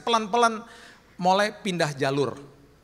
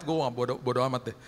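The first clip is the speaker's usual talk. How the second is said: ordinary delivery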